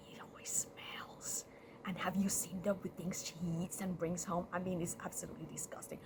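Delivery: hushed, half-whispered gossip; secretive backbiting. Acted. Rat gossiping behind Cat's back, hushed and disgusted. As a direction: badmouth someone behind their back, very softly. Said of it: "behind Cat's back, Rat was all like".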